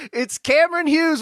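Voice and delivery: announcer build-up. Acted. Host announcing guest with flourish.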